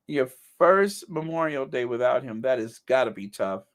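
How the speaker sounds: usual speaking voice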